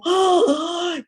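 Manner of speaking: whiny near-crying lament ending in a high loud wail; mock wail. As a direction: ham up the despair over losing her snack. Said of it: "Y yo dije".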